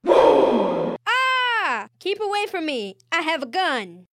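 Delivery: high, loud hammed-up terror; terrified scream. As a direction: shriek and back away. Villain terrified of Bulk.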